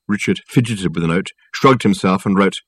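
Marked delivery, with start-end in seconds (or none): none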